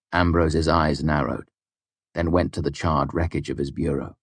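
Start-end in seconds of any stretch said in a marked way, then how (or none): none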